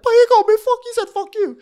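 very high, loud mock wail; hammed-up tearful tattling; James. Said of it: "immediately starts crying".

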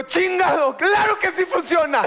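high, shouted mock outburst; hammed-up yell, acting out the fight; acted angry shout. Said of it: "Estoy actuando".

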